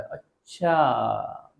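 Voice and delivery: long drawn-out, knowing "oh I see"; mock realisation. Speaker feigning sudden insight for listeners, drawn-out mock realisation. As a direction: stretch it out slowly, as if it dawns.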